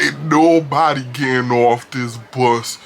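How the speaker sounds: put-on slow, heavy drunk threat; drunkie's voice. Drunk man with a knife blocking the exit, slow. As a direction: drag it out like a drunk. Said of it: "He could".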